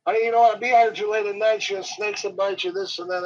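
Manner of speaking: a concerned lady warning him off the bank; lady's warning. Retold or imitated. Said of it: "she says".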